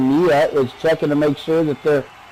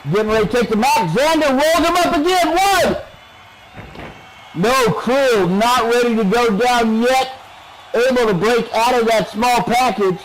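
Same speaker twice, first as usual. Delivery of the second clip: live play-by-play; 6 semitones higher than usual. Real, not acted. Announcer calling a near pin for viewers, excited play-by-play. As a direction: pitch climbing to a high pin call.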